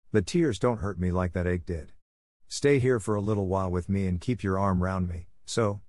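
usual speaking voice

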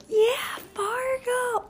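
high, drawn-out whispered cheer. Retold or imitated. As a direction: cheer for the team, stretched out. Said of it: "I was like".

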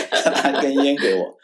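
laughing through the words. Real, not acted. Officer recalling the commander's cigarette, laughing. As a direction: chuckle while telling it.

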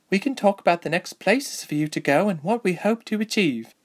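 high, lively elf soldier; Deirdre. Acted. Voices Deirdre.

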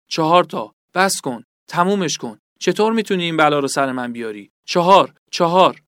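tortured man crying out the same answer; desperate pleading. Acted. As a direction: beg your torturer to stop. Tortured man begs interrogator to stop.

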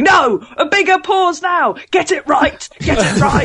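high, hammed-up shouted orders; mock shouting. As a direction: imitate a bossy director yelling. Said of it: "you shouting at me".

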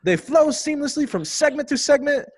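high, hammed-up Aussie voice reading a review; over-the-top Aussie review read; Australian accent. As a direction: put on a bouncy Australian accent. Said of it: "I want you to do an Australian accent".

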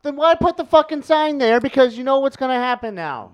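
high, loud mock outrage; exasperated protest. Man tempted by a do-not-push button sign, exasperated. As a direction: argue it as if wronged by temptation.

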